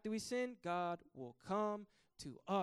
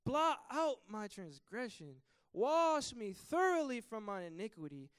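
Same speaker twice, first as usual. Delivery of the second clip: preaching scripture; 3 semitones higher, 5 dB louder than usual. Acted. Preacher reading Psalm 51 aloud to the congregation, fervent. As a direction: proclaim the psalm with full force.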